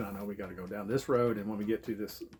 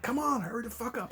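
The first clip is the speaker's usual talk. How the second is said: high-pitched reenacted urgency; impatient urging; 13 semitones higher than usual. Man rushing drunk girl from the ocean, impatient. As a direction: hurry her along, voice pitched way up.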